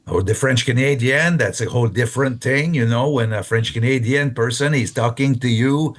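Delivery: Québécois English with misplaced H sounds; French Canadian accent. Shown as a demonstration. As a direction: speak as a French Canadian, adding and dropping H. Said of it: "he put a H where there is no H".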